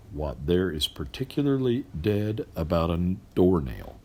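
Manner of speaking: ordinary delivery, nothing marked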